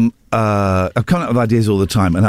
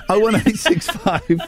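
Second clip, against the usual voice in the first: laughing; 7 semitones higher, slower than usual. Real, not acted. Laughing through phone number.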